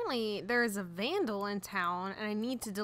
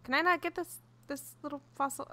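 ordinary talk, nothing marked

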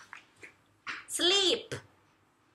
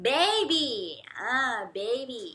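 put-on "baby" said slow and soft; slow soft cradling word; 6 dB quieter, much slower than usual. Shown as a demonstration. Mother rocking her baby to sleep says "baby" softly. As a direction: murmur "baby" slowly as if rocking. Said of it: "อุ้มเด็กนอน".

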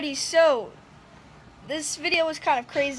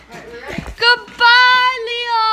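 calling goodbye; 10 semitones higher, 11 dB louder, much slower than usual. Real, not acted. Saying goodbye to her pet.

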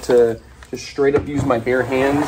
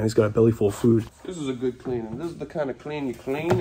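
ordinary delivery

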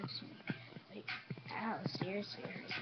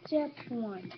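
ordinary delivery

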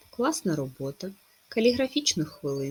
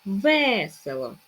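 "Весело" slow, with stretched ве; "Весело", drawing out the first е; stress on first е; 5 dB louder, much slower than usual. Shown as a demonstration. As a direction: lean on the first syllable of "Весело". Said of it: "Наголос на першу е".

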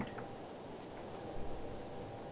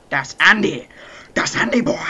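gloating; 6 semitones higher, 9 dB louder than usual. Pretend. Gloating in game.

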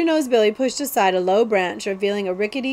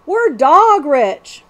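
very slow, louder, drawn-out glee; gleeful gloat; 6 semitones higher, 9 dB louder, much slower than usual. Acted. Cutwater gloats about their wealth, slow and loud. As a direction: savour the riches. Outlaw gloats over the crown.

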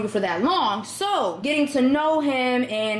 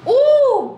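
high, loud ooh of pleasure; delighted ooh; 14 semitones higher, 7 dB louder than usual. Real, not acted. Delighted ooh at how the perfume smells. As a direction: swoon at the scent.

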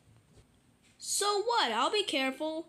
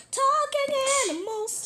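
much higher squeaky chant; 12 semitones higher, 4 dB louder than usual. Acted. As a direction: jump way up into a squeak. Theme song, squeaky high take.

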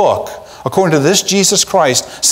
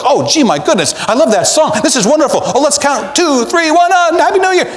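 much higher, giddy mock partygoer on the Titanic; carefree partygoer; 5 semitones higher than usual. Preacher playing an oblivious Titanic ballroom guest, giddy and high. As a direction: ham up a vain guest fussing over suit and hair.

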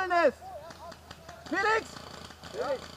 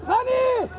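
high, loud shout of a name; calling out loud; 6 semitones higher, 6 dB louder than usual. Real, not acted. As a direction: yell across the field. Calling a teammate across battlefield.

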